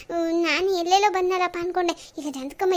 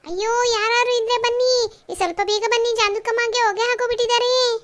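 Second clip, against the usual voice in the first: crying out; 3 semitones higher, 5 dB louder than usual. Acted. Visitor finds old woman dead and calls for anyone, loud alarmed cry. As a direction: call the neighbours in alarm. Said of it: "ಯಾರದು ಬಂದಂಗ್ ಕಿರ್ಚಾಡ್ತಾ ಇರೋದು?".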